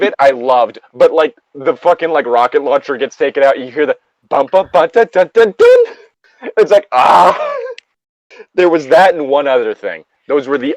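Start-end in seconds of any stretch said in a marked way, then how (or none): 3.6-5.8 rising hummed bum bum dun dun; mock sound effect. Friend mocking a cheesy stock sound effect, hummed rising. 6.9-7.6 slow stretched mock cry; mock stock scream. Mimicking the stock scream from the anime, slow mock cry.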